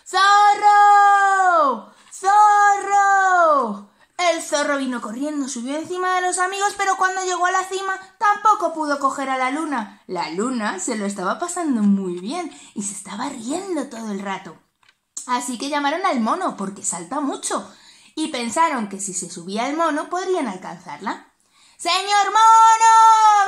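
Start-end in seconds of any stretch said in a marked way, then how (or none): 0.1-3.9 grito más agudo y alargado; llámalo a voces desde lejos; llamada a gritos. Acted. Llamando al zorro. 21.8-23.5 llamada alta y cantada, muy alargada. Acted. Llamando al mono.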